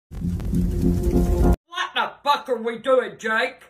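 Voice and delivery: ordinary talk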